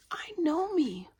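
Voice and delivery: high, loud self-assertion; defiant assertion. Real, not acted. Standing up to those who misjudge her, defiant. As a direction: declare it loudly, pitch jumping up.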